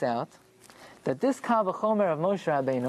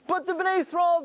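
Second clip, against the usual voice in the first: emphatic objection; 11 semitones higher than usual. Shown as a demonstration. Teacher raises objection loudly.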